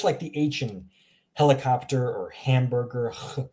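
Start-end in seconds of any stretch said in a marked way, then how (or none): 3.1-3.4 "H" as huh from the throat; throaty H. Shown as a demonstration. Teacher shows where it comes from, throaty "H". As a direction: push "H" out as a short throat sound. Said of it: "it's coming from your throat".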